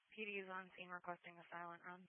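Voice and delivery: usual speaking voice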